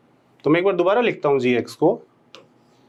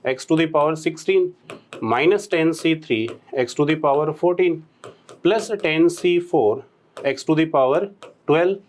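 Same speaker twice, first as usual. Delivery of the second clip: slow, word-by-word dictation while writing on the board; 2 semitones lower, slower than usual. Shown as a demonstration. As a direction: spell each term out slowly as you write it. (धीमे-धीमे लिखवाते हुए बोलना)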